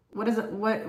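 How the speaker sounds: ordinary talk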